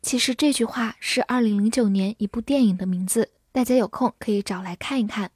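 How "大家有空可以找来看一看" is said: normal talking voice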